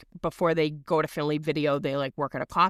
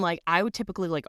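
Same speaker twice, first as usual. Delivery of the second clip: usual speaking voice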